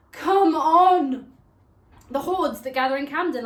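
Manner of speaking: loud, high burst of exasperation; exasperated shout. Acted. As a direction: throw it out as a loud protest. Exasperated rant about tourists.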